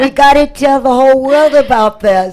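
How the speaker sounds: high, loud, delighted invitation; eager and excited. Real, not acted. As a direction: cheer her on to read it. Host hypes the guest's mission.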